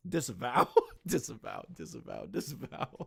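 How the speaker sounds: words breaking into low, quiet chuckles; laughing. Real, not acted. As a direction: repeat it through helpless laughter. Cracking up, repeating the word.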